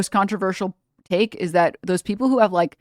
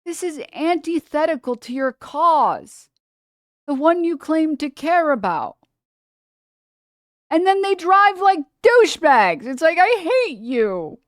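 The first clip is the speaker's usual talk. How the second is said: high, loud exasperated ranting; heated rant; 9 semitones higher, 5 dB louder than usual. Real, not acted. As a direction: vent at the truck drivers.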